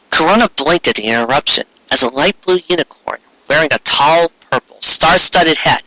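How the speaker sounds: ordinary delivery, nothing marked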